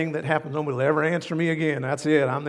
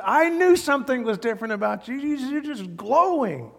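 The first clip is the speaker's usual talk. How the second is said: delighted exclamation; 10 semitones higher, 5 dB louder than usual. Retold or imitated. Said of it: "We say".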